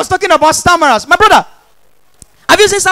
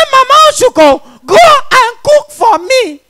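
demanding shout; 7 semitones higher, 5 dB louder than usual. Retold or imitated. Husband demanding in retold story.

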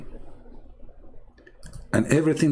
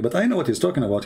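ordinary talk, nothing marked

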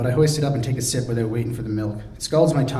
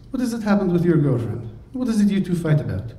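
lightly breathy Radmila; 6 semitones higher than usual. Acted. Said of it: "in a breathy voice".

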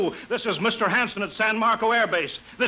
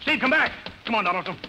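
calling out; 4 semitones higher than usual. Acted. Calling after fleeing man.

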